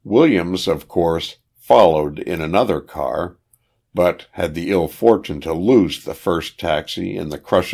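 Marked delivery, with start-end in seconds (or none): none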